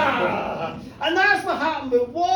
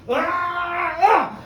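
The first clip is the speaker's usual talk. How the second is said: high, loud fighting roar; drunken roar; 6 semitones higher, 5 dB louder than usual. Acted. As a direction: bellow at your mate like a brawling lad. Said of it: "they're roaring each other".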